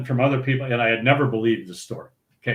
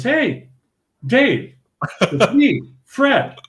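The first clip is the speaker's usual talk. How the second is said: eager greeting; 10 semitones higher, much slower than usual. Retold or imitated. Acting friend greeting Brenner.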